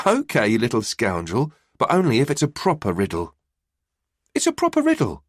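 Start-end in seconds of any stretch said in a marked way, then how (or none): 4.3-5.2 put-on high, cheeky child echo; cheeky boy voice. A narrator's reading. Boy teasing his mum, mimicking her in a high child voice. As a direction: tease mum back in a kid's voice. Boy repeats mum's words back.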